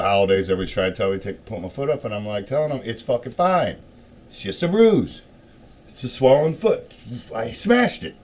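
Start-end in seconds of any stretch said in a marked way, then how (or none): 2.8-8.1 loud, high, fed-up insistence; exasperated insistence. Retold or imitated. Loudly brushing off family telling him to rest.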